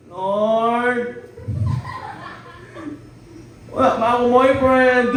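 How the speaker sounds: lightly nasal, pleading prayer; hammed-up nasal plea to the Lord; mock nasal voice. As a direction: play a girl with a nasal impediment begging God. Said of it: "nagkataon si Nicole, ngongo".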